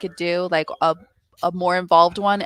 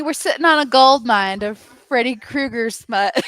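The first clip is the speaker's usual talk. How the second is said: ordinary talk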